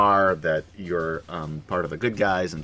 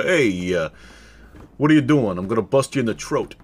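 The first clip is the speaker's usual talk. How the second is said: hammed-up Brooklyn tough talk; Brooklyn accent. Shown as a demonstration. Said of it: "give us a, uh, Brooklyn accent".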